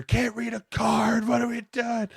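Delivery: high, slow, mocking coach voice; exasperated coach. Player mimicking his defensive coordinator's baffled outburst. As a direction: whine it like a baffled coach who can't follow the card. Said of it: "Leslie Frazier".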